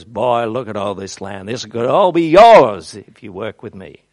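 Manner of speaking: a lightly done southern drawl; a hint of a slow Southern wheeler-dealer drawl; a mild Southern accent, coaxing David. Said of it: "with a big southern drawl".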